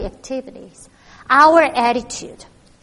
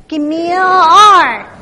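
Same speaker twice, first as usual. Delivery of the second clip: loud, slow cheer call; cheerleader call; 3 semitones higher, 11 dB louder than usual. Acted. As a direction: lead the crowd in a cheer.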